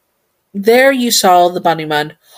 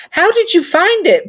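amazed question; 13 semitones higher than usual. Shown as a demonstration. Girl thrilled by Bunny Mun's childhood gift, squealing in amazement. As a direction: squeal it in delighted disbelief. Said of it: "looking at him wide-eyed".

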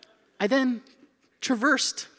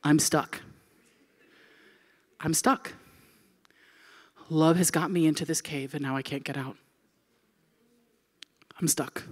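low, hushed realization; acted stunned dread, voice sinking; dawning dread; 7 semitones lower, 5 dB quieter than usual. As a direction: quietly let it sink in. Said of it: "And it hit me".

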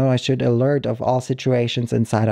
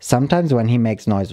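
usual speaking voice

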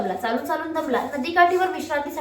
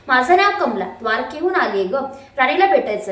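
ordinary delivery, nothing marked

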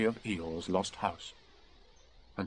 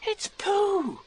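put-on high-pitched boyish delight; thrilled little boy; excited; 3 dB louder than usual. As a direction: squeal it in happy recognition. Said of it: "said Christopher Robin excitedly".

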